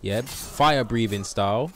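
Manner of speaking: normal talking voice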